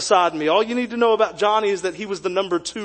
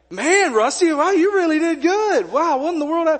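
much higher, astonished praise; amazed coach; 9 semitones higher than usual. Retold or imitated. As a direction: gush like a stunned coach. Coach amazed by his time.

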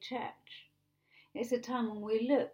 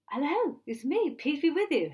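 bright, higher, louder acted hello; cheery greeting; 5 semitones higher, 5 dB louder than usual. Jesus surprises the believers, cheery raised greeting. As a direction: pop up with a loud, lifted greeting. Acts out Jesus suddenly appearing.

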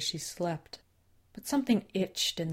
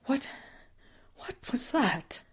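shaken; 8 semitones higher than usual. Acted. Shaken after attack.

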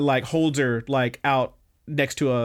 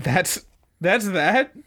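plain reading voice

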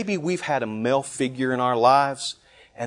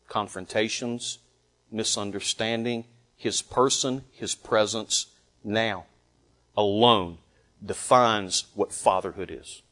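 hushed solemn; 7 semitones lower, 8 dB quieter than usual. Acted. Quiet solemn restatement.

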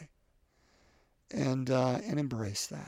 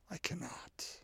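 whispered confession; 3 semitones higher, 16 dB quieter, slower than usual. Retold or imitated. Jeremiah admits he can't hold back.

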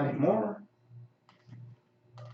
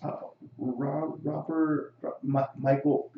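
ordinary talk

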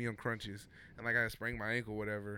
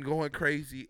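normal talking voice

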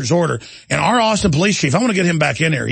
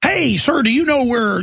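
a higher, polite stranger asking the way; mock polite tourist; 8 semitones higher, slower than usual. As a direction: lightly play a lost visitor. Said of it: "somebody said to me".

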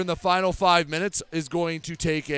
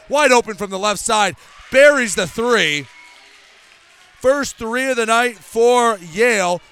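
excited call; 4 semitones higher, 6 dB louder than usual. Real, not acted. Made three, excited play-by-play call.

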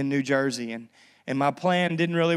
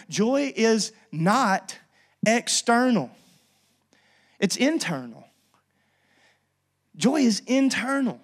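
preaching; 5 semitones higher, slower than usual. Acted. Preaching points.

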